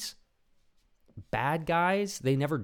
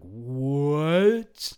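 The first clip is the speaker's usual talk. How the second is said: loud, high, slow astonished gasp of a viewer; shocked viewer; 9 semitones higher, 7 dB louder, much slower than usual. Pretend. Viewer realizing the crying was fake, loudly astonished. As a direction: react like the twist just landed. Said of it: "the viewer is supposed to be like".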